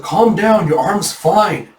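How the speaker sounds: loud exasperated calming; urgent reassurance. Acted. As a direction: talk down a panicking friend forcefully. Companion snaps at panicking bandit.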